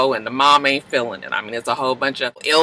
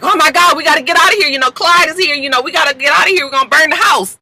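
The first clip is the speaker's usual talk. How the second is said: high, loud panicked mom; urgent alarm, wake everyone; frantic; 10 semitones higher, 3 dB louder, faster than usual. Retold or imitated. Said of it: "her mom is all frantic".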